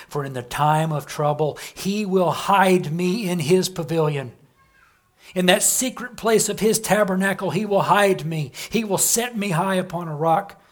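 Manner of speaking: preacher's swelling declamation; full-force preaching. Acted. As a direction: proclaim it to the back row.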